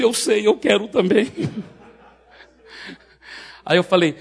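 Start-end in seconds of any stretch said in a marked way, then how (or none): 0.0-1.3 higher, loud mock-crying voice; imitated tearful husband. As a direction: blurt it out as if bawling. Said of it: "marido que ainda tava chorando".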